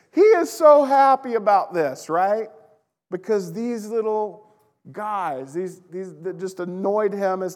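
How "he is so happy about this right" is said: high, loud, gushing with joy; preacher bubbling over with excitement about Jesus; giddy excitement. Real, not acted. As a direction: burst out giddy and thrilled.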